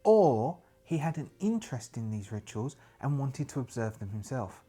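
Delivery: soft, hushed diary reading; journal entry narration. Acted. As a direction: read the journal like a grim investigator's log. Reads journal entry in character.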